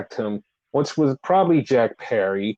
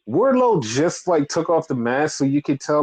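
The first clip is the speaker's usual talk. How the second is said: normal talking voice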